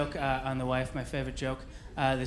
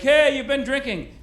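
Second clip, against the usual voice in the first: higher, louder call-out; mock accusation, pitched up; imitated accusing manager; 8 semitones higher, 5 dB louder than usual. Manager confronts young dishwasher, in a raised mock accusation.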